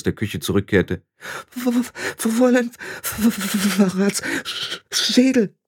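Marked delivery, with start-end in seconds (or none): 1.6-5.5 high stammer through chattering teeth; freezing girl stammering. Acted. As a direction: stutter as if frozen to the bone. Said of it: "ihr klapperten die Zähne".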